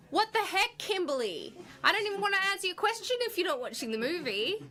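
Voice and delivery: high-pitched playful outrage; mock aggressive. Actress scolding a fan who won't watch, mock aggressive. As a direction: scold in exaggerated, affronted disbelief. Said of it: "got very aggressive, didn't I?".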